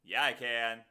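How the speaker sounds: ordinary talk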